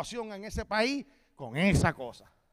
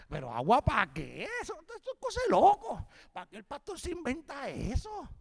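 incredulous servant; 6 semitones higher, 4 dB louder than usual. Acted. Voicing a baffled, doubting servant.

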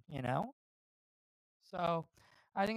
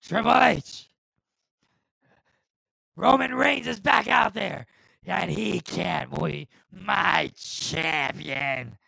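booming wrestling-boss bellow, words dragged out; Vince McMahon; 12 dB louder, much slower than usual. Acted. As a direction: bellow it like an outraged company chairman. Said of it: "a nice little Vince McMahon impression".

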